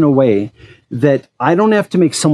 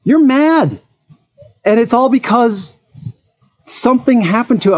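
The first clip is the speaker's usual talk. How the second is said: higher, pointed telling-off; retold confrontation; 9 semitones higher than usual. Telling brother-in-law he's mad over a scratch, higher and pointed. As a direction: call him out on being upset. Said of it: "I'm like".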